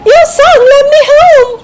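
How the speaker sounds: high squirrel voice, loud and thankful; a happy little squirrel thanking his rescuer; high, glad squirrel voice; grateful squirrel. A narrator's reading. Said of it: "he said".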